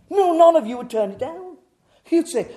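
high, loud, rousing pulpit delivery; full-force preaching. Acted. Preacher imagining the bank's offer to congregation, full-force preaching. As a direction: preach it loud to the whole room.

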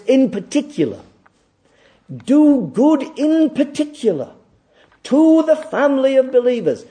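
fervent preacher's call; impassioned preaching. Acted. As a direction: proclaim it like a command from the pulpit. Preaching at full force.

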